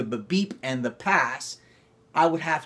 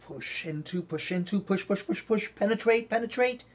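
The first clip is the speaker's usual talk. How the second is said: pushing, rising steadily much higher; building push; 7 semitones higher than usual. Shown as a demonstration. Acting teacher guides class through penetrate, rising higher. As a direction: drive each push further and higher. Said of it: "let's do penetrate first".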